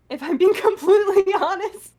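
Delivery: laughing. Real, not acted. Laughs mid-admission.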